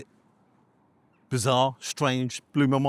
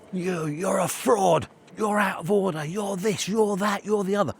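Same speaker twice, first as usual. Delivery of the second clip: high-pitched mock rant at someone; retold angry shouting; 8 semitones higher than usual. Angry stranger confronts the reviewer in the high street, mock shouting. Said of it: "could you stop shouting".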